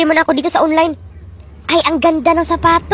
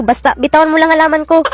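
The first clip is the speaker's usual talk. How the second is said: ordinary delivery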